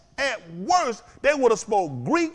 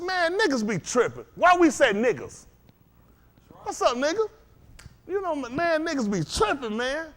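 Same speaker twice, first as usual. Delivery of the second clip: higher-pitched, casual street greeting; lightly mocked street talk; 7 semitones higher than usual. Teacher quoting street slang to his listeners, lightly mocking. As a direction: lightly put on a loose street voice.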